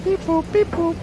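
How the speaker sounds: very high, flat beeping robot. Pretend. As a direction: chirp it like a little robot. Robot noise bit between guesses.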